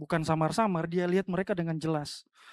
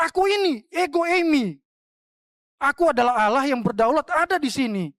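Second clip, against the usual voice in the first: proclaiming; 7 semitones higher, 5 dB louder than usual. Acted. Jesus voiced, preaching climax.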